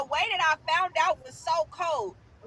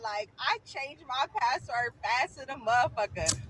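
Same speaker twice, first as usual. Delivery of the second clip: ordinary delivery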